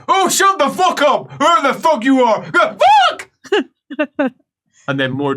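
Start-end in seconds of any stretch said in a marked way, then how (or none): 0.1-3.3 high, loud yell ending in a yelp; acted angry retort. Patient yelling at unseen heckler, acted angry shout. Another goes; patient yells back.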